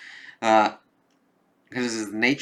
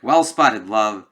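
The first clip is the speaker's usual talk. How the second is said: mock villain praising, louder; hammed-up praise; 10 dB louder, slower than usual. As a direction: toss it out with swagger. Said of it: "he's like".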